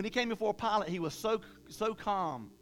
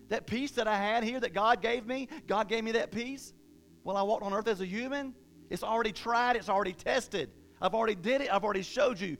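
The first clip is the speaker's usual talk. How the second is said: higher, louder impassioned preaching; preaching at full force; 5 semitones higher, 4 dB louder than usual. Acted. Preacher building to a climax, loud and fervent. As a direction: drive it home to the congregation.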